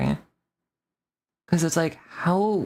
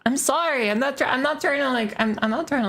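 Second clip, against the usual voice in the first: high, fast, flustered backpedal; flustered apology; 9 semitones higher, faster than usual. Real, not acted. Streamer apologising to Luna, high and flustered. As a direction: rush out a squeaky apology. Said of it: "you're getting me fired up".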